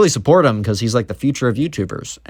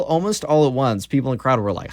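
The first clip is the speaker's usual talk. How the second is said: usual speaking voice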